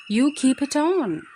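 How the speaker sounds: high, loud scolding order; a loud, high mock telling-off; stern scold. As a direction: tell the cat off, loud and sharp. Wife scolds the cat.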